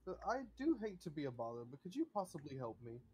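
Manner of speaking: a courteous butler asking a favour; ghost butler. Acted.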